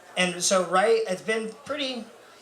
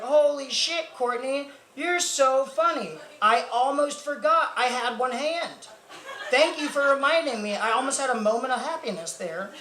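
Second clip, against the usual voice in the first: mock-grateful sarcasm; 7 semitones higher than usual. Comic retorts to coworker's one-hand joke, mock-grateful sarcasm. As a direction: gush with exaggerated fake gratitude.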